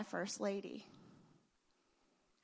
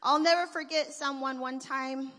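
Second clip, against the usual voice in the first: ordinary delivery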